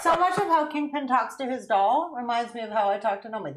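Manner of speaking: ordinary talk, nothing marked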